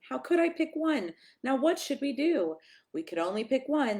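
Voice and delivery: usual speaking voice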